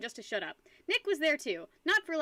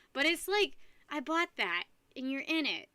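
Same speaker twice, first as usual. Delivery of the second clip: usual speaking voice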